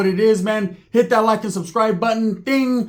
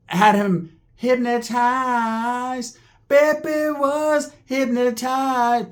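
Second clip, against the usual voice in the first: drawn-out trance; 4 semitones higher, much slower than usual. Acted.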